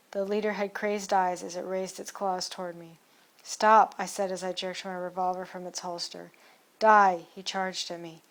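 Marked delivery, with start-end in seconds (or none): 3.4-3.9 shouted stop. Acted. Narrator facing charging leader. 6.8-7.3 loud, violent cry; snarled die. Acted. Leader charges at narrator.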